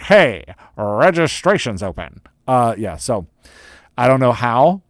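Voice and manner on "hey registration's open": slow, drawn-out announcement; playful, over-stretched promotional call; announcer-style hype. Acted. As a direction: stretch out HEY and roll into the news. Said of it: "gonna say it like that".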